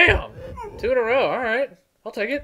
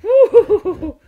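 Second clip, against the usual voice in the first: loud high woo; whooping laugh; 10 dB louder than usual. Real, not acted. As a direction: whoop with glee. Cheering in game fight.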